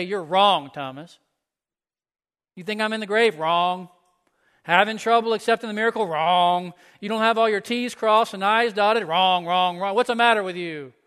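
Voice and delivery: loud, scolding voice, hammering each "Wrong"; mock harsh rebuke. Preacher voicing a harsh Jesus, to show what he did not say, loud and scolding. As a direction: bark out every wrong like a stern judge.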